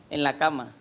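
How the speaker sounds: ordinary talk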